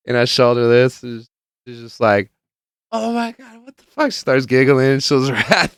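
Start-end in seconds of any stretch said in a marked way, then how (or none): 2.9-4.1 very high imitation of an astonished friend; high-pitched retold amazement; mock disbelief. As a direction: squeal it in put-on shock.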